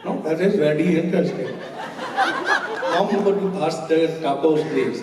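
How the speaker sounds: a teen's running joke in a put-on Indian accent; mock Indian accent. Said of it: "in an Indian accent".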